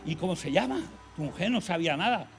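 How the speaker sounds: friends surprised. Retold or imitated. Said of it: "me decían".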